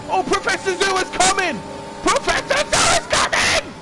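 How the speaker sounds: screaming excited call; motorsport commentator. Real, not acted. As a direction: yell the overtake in high pitch. Overtake on the track, yelling.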